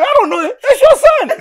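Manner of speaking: very high, laughing protest; mock exasperation. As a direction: squeal it as a comic inner retort. Acting his exasperated thought, laughing.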